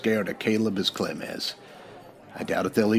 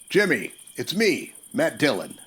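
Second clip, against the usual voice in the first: raised, higher call across the dark; 7 semitones higher than usual. Acted. Marshal calls up to the boy hiding with a rifle. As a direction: call out to him from a distance. Marshal calling out to hidden gunman.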